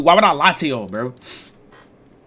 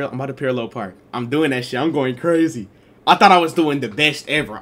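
normal talking voice

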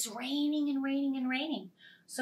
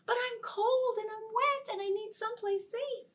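very high pleading mouse; squeaky mouse; 12 semitones higher, faster than usual. Acted. Mouse begging the others, very high. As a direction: squeak out the plea.

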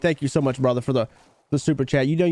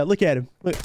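usual speaking voice

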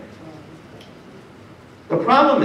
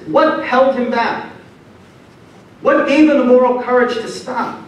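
ordinary talk

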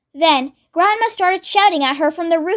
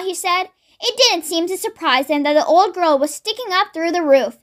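ordinary delivery, nothing marked

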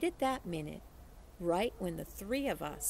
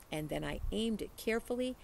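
ordinary delivery

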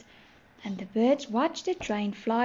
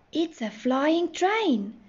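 drawn-out, higher-pitched storybook exclamation; mild excited shout; 6 semitones higher, slower than usual. A narrator's reading. Children shout on seeing the flying train, mild slow excitement. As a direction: marvel aloud, slowly and higher. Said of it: "shouted at once".

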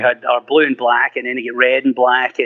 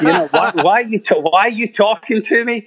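higher, half-laughing bewilderment; baffled at the phone; 7 semitones higher than usual. Retold or imitated. Scolding his new iPhone, amused and baffled.

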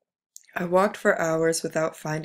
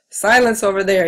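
loud barked yell, much louder; officer's yell; 3 semitones higher, 11 dB louder than usual. A narrator's reading. Officer yelling at the screaming prisoner, loud furious shout. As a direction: roar it across the barracks. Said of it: "yelled the officer".

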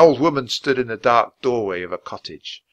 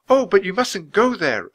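high, louder urgent warning; crying out; 10 semitones higher, 5 dB louder than usual. A narrator's reading. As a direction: warn them off like an alarmed girl. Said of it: "a girl cried".

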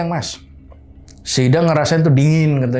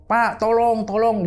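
high-pitched mock yell, held back in volume; retold scream for help; 12 semitones higher, slower than usual. Frightened tenant begging landlord for help, high mock scream. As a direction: imitate a cry for help, pitched way up. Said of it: "Dia teriak sekenceng-kencengnya".

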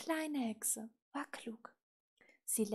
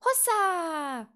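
triumphant rider's cry; 5 semitones higher, 11 dB louder than usual. A narrator's reading. Little witch to her bucking broom, triumphant whoop. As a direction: scold the broom, then a long loud high whoop.

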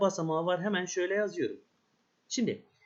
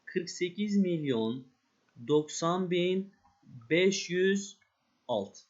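slow, spaced-out number reading; teacher reads the answer slowly to the class; slow summing up; slower than usual. Shown as a demonstration. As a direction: read the full number slowly, chunk by chunk. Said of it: "benim sayım neymiş aslında".

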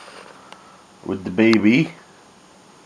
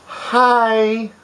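much higher sing-song hi; baby talk hi; 11 semitones higher than usual. Shown as a demonstration. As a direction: coax the baby to copy you. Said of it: "Can you say".

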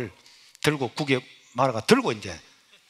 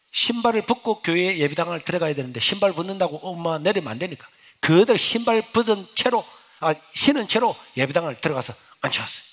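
ordinary delivery

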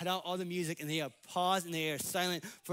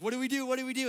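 mock panic; 7 semitones higher, 3 dB louder, much faster than usual. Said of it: "They're like".

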